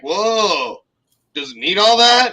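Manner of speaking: drawn-out whoa into an incredulous question; retold astonishment. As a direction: imitate a stunned onlooker, rising in pitch and volume. Retold onlooker's surprise at the back.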